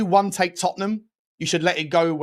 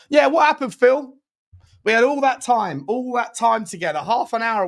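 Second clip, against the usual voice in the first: mock reproach; 7 semitones higher than usual. Real, not acted. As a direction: tease Phil for abandoning him. Mock-accusing call to Phil.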